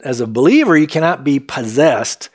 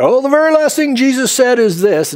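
higher-pitched, lightly mocking quote; mock book quote; 7 semitones higher than usual. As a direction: imitate an overconfident claim. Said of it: "there's a book out there".

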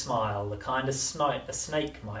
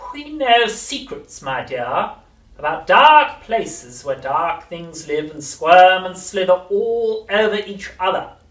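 very slow, throaty, menacing crone; throaty witch whisper; 9 semitones higher, 16 dB louder, slower than usual. A narrator's reading. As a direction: lean in and menace. Grandma menacing.

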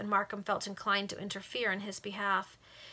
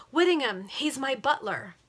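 higher, louder cry of recognition; astonished exclamation; 5 semitones higher, 6 dB louder than usual. Acted. Richard learning his butler was there, astonished. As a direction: blurt out the name in surprise.

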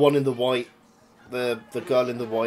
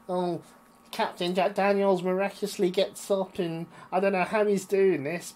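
higher, mock-amazed commentary voice; mocking commentator; 7 semitones higher than usual. Commentator Stacks slagging off the wrestler, mock-amazed higher voice. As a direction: ham up a sarcastic commentator. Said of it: "Stacks going".

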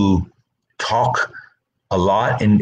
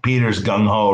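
ordinary talk, nothing marked

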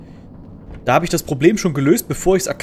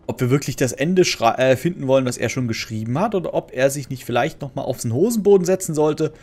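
ordinary talk, nothing marked

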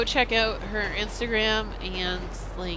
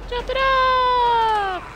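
excited yell; 5 dB louder, much slower than usual. Real, not acted. Excited stunt jump.

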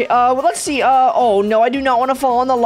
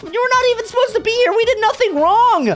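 ordinary talk

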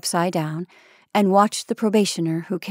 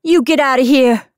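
loud, high angry order; fierce; 6 semitones higher, 8 dB louder than usual. A narrator's reading. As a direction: drive someone away fiercely. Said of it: "she said fiercely".

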